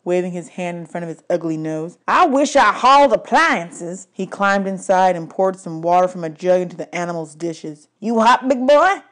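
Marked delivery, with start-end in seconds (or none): 2.1-4.1 slow, louder, higher grumbling trucker; gruff trucker. A narrator's reading. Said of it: "one of the truckers said". 8.0-9.0 louder, higher taunting trucker; mocking trucker. A narrator's reading. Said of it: "he asked the lion".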